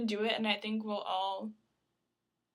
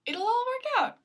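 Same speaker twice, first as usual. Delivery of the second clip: high pitched; 13 semitones higher, 8 dB louder than usual. Real, not acted. Cheery reassurance after confusion.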